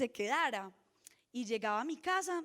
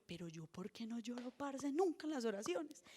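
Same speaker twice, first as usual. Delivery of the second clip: quiet retold lament; 8 dB quieter than usual. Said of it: "yo decía".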